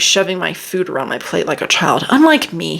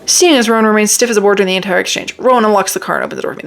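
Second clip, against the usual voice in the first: rushed reading; much faster than usual. Shown as a demonstration. Reviewer racing through a rushed scene to mock it, fast. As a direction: read it fast to show the ending feels hurried. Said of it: "This part was absolutely rushed".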